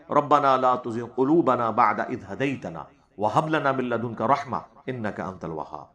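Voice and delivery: slow, low, measured Quranic chant; Quran recitation. Acted. Scholar recites the prayer verse to his audience, slow and low. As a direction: draw out each Arabic word prayerfully.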